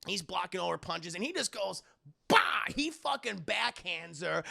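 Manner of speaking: ordinary talk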